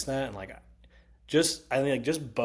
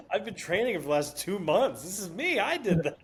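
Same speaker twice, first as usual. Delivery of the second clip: mock brag; 9 semitones higher than usual. Mocking a friend's brag.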